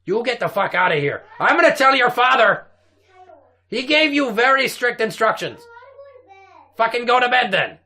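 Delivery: loud, high scolding shout; angry scolding. Acted. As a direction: yell at the kids to go to bed. Scolding kids to bed.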